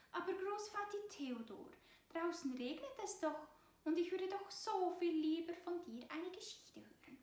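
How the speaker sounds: high little mouse voice, pleading; pleading mouse child. A narrator's reading. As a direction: coax grandpa sweetly. Zipora pleads again. (hohe, bittende Mäusekinderstimme)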